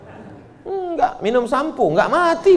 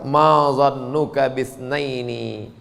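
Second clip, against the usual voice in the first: recited verse; 4 semitones lower, slower than usual. Acted. Quranic quote recited slowly.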